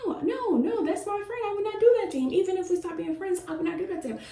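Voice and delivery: high-pitched insistent denial, retold; upset child's protest. Boy defending his friendship to a parent, upset high protest. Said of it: "he started crying again".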